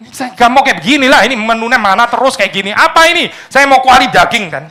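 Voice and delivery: high, loud mock grumbling at God; whiny complaint. Preacher voicing an ungrateful believer whining to God, high and loud. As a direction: sulk and demand like a spoiled child.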